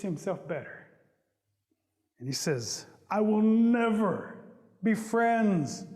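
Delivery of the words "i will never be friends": slow, loud, stretched-out declaration; aggrieved vow. Acted. Possum renouncing Rabbit after the beating, aggrieved. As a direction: swear it off, dragging the key words.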